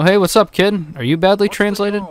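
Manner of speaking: ordinary talk, nothing marked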